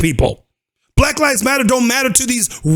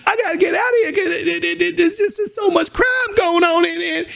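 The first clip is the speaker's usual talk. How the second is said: put-on high, pleading old woman's voice, close to tears; panicky old lady; 5 semitones higher than usual. To the housing agent, a frightened old lady begging to move. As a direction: imitate a frightened elderly caller who needs to move.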